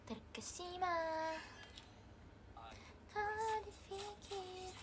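slow, soft, dreamy recital of lines; slow lyrical recital. Acted. Reciting romantic lines to the viewers, slow and soft. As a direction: linger on each phrase, soft and slow.

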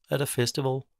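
ordinary delivery, nothing marked

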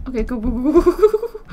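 escalating panicked chant; panicked urging. Real, not acted. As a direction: hurry yourself on in panic. Fleeing zombie in panic.

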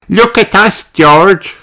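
put-on high, loud little-girl voice; Peppa. A narrator's reading. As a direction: call out high like an excited child. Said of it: "says Peppa".

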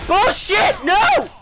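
high, loud cry of disbelief; angry outburst. Real, not acted. Gamer yelling at the game, angry outburst. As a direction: shout it in outraged protest.

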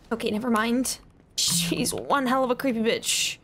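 normal talking voice

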